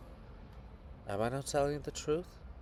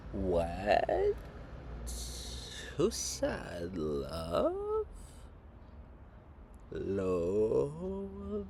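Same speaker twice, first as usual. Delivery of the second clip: distant, drawn-out voices from the street corners; lightly voiced chorus from the streets; 3 semitones lower, 3 dB quieter, much slower than usual. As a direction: call it out slowly like a far-off chorus. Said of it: "Chorus from various corners of the streets".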